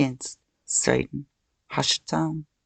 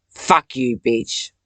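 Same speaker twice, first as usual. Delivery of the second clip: loud, snarled curse; furious shout; 5 dB louder, much slower than usual. Real, not acted. Cursing Satan out of her head, furious shout. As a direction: spit it out in rage.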